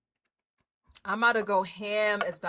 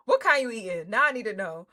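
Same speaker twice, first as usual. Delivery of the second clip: usual speaking voice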